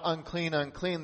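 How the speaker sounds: usual speaking voice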